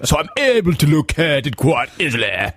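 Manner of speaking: high, swooping British toff with stretched vowels; hammed-up affected British parody; mock posh British. Fake Londoner spins out the story, mock posh British. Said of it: "started talking like that".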